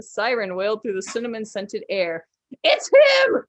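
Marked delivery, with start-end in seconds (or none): 2.6-3.4 shrill high terrified scream; panicked scream. Acted. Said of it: "someone screamed".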